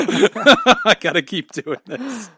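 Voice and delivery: slow and loud through laughter; laughing. Real, not acted. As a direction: drag it out while laughing. Laughing at own bit.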